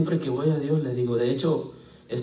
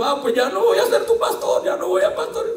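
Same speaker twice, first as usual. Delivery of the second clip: mock tantrum. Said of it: "Y voy a decir yo".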